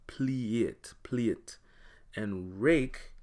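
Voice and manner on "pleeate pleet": "pliate" said slowly as pleeate, then "plate" as pleet; Patois pleeate. Shown as a demonstration. Teacher showing learners the Patois "plate". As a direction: Jamaican "plate" with the E-A sound. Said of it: "would sound like pliate".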